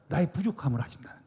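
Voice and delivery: 나의 부족함을 아신다는 거예요 whispered, quick and breathy. Real, not acted. Preacher whispering to the congregation that God knows our weakness. Repeated phrase, whisper then full voice.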